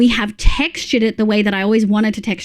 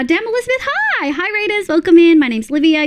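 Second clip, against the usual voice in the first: high, bright greeting to raiders; excited welcome; 8 semitones higher, faster than usual. Real, not acted. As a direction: welcome the raid with delight.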